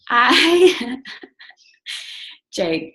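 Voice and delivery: can't hold back the giggle at Jake; laughing. Real, not acted. Laughing because her husband Jake is cracking up. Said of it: "you're making me laugh".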